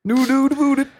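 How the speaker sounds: high, hammed-up Canadian accent; Canadian accent, played for laughs; mock Canadian accent. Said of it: "do your best Canadian accent back at us".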